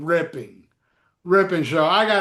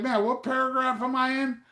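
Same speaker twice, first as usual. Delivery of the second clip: confused reader; 6 semitones higher than usual. Retold or imitated. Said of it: "I'm like".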